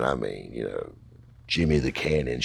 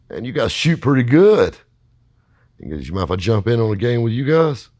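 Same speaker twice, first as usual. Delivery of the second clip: loud, high, chummy hustler voice; friendly hustler; 8 semitones higher, 8 dB louder than usual. Retold or imitated. Peg chatting up the young players, loud and friendly. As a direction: sucker the kids in with easy charm.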